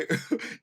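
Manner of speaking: short unvoiced burst of laughter; breathy laugh. Real, not acted. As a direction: laugh at the rude line. Laughing at the lyric.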